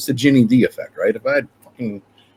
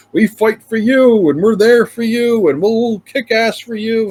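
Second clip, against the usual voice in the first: slow, much higher mock TV-ad pitch; cheesy lawyer ad; 11 semitones higher, slower than usual. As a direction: ham it up like a bus-stop lawyer commercial. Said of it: "every state has that lawyer".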